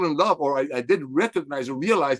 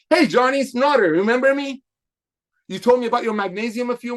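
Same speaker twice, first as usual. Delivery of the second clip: friendly phone greeting; 7 semitones higher than usual. Shown as a demonstration. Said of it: "role-play call to Johnny".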